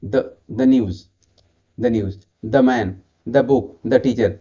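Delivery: the को छोटा द बोलो; the news में the को द कहो. Shown as a demonstration. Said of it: "For example". (व्यंजन से पहले the, द जैसा)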